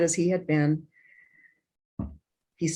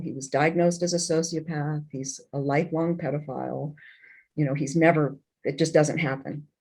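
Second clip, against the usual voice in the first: ordinary delivery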